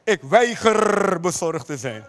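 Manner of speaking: "weiger" drawn out with a long rolled r; low, slow, defiant hit on "weiger"; emphatic refusal. Real, not acted. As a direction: trill and hold the end of "weiger". Preacher repeats his refusal.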